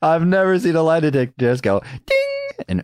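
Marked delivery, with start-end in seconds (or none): 2.1-2.7 high sung-out ding noise; ding like a game show bell; mock ding. Friend mimicking a lie detector ding, high.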